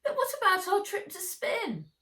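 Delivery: put-on high, loud boyish wail; protesting. A narrator's reading. Boy losing his promised trip, protesting. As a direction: whine it loudly in protest.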